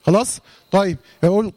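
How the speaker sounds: usual speaking voice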